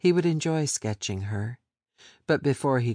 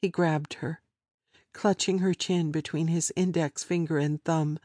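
ordinary delivery, nothing marked

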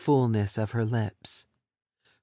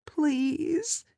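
high, small plea; woman pleading through tears; pleading victim. Acted.

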